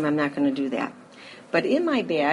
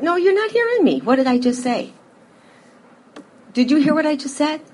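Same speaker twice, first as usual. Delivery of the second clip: higher, insistent husband complaining; mock exasperated husband, higher and louder; husband's frustrated pleading; 7 semitones higher, 4 dB louder than usual. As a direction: push back like a frustrated spouse. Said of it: "And he'd say".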